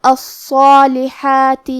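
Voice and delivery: الصالحات with a heavy, full-mouthed ص and a long heavy الف; slow chanted الصالحات with thick ص; heavy emphatic ص. Shown as a demonstration. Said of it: "بھاری آواز میں پڑھنا".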